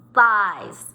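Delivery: usual speaking voice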